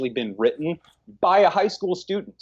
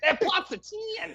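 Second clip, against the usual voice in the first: high mock echo; 5 dB quieter, slower than usual. Real, not acted. Echoing cohost's hyped rating.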